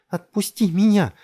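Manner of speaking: high, loud, furious snarl; growling. A narrator's reading. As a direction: fight free with a raging yell. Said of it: "зарычала Джессика". (яростно, с рыком кричит)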